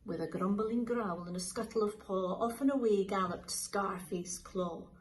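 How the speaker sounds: plain reading voice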